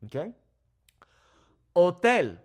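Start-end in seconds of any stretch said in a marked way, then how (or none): 1.8-2.4 Hotel as oh-TEL; Spanish "Hotel" without any h; silent H. Shown as a demonstration. Teacher models the silent letter, Hotel as oh-TEL. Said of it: "the H is silent here".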